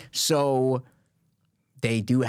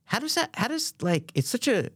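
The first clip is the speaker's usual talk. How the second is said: pitched way up in disbelief, then settling; a stunned, high "how does that" that levels off; incredulous; 6 semitones higher than usual. Retold or imitated. Reacting to the channel's line, high and incredulous. Retelling his disbelief at the video.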